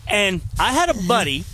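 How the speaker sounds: ordinary talk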